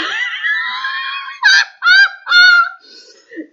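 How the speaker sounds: a burst of high, loud laughter; helpless high shriek of a laugh. Real, not acted. Laughing at own story.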